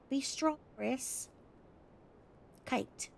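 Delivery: put-on letter read aloud in an Australian accent; Aussie accent. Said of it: "that was my best Aussie voice".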